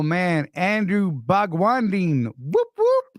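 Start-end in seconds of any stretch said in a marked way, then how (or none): 2.4-3.0 high, squeaky whoop whoop; mock cheer. Real, not acted. Host cheering himself for getting it right. Cheering a first-try success.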